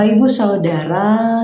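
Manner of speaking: usual speaking voice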